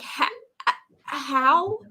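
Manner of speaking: ordinary talk, nothing marked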